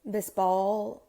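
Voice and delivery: soft, hushed meditation guide with unhurried pauses; guided meditation. Acted.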